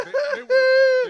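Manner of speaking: high, slow, breaking into laughter; words lost in laughter; laughing hard. Real, not acted.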